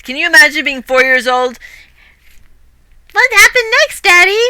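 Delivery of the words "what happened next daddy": put-on very high, loud child asking eagerly; little child voice. Small child begs daddy for the rest of the story, high and eager. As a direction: squeak it like a four-year-old. Imitating a four-year-old child.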